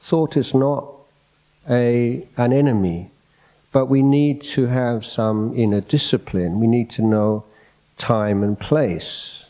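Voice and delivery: ordinary talk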